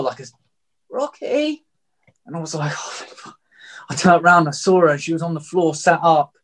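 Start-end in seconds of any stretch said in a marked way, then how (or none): 0.9-1.7 put-on girlfriend's weak call. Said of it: "I just heard on the floor". 2.7-3.4 breathless whisper of relief. Retold or imitated. Hearing his injured girlfriend call his name, he whispers his relief.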